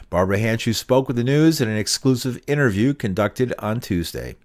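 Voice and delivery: scripted radio news narration; news read. Acted. Reporter introducing an exclusive interview to listeners, scripted news read.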